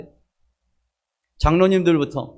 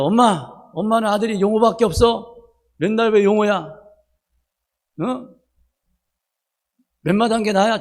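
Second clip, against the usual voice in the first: Jeolla dialect complaint; 4 semitones higher than usual. Retold or imitated. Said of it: "전라도 사투리로".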